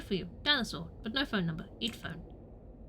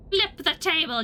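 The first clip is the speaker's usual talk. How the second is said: loud, high call; rowdy gamer yell; triumphant shout; 6 semitones higher, 8 dB louder, slower than usual. Pretend. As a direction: shout it with gleeful abandon. Triumphant in-game action.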